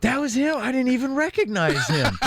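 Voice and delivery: high, surprised disbelief; surprised realization. Retold or imitated. Husband realizes his mistake.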